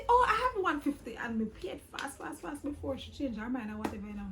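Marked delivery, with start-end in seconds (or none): 0.1-1.2 mock surprised relief. Retold haggling with airport agent.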